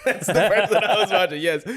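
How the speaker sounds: loud, high laughing voice; laughing admission. Real, not acted. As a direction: blurt it out through laughter, delighted to be found out. Caught out, admits laughing.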